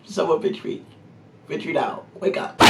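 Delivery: high, soft whimpering disbelief; mock despair. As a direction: whimper as if trapped in a bad dream. Retold mortification in the cart.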